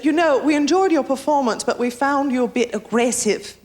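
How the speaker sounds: mock-British reproach; London woman. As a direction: imitate a politely disapproving British woman. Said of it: "this woman comes up to me and said".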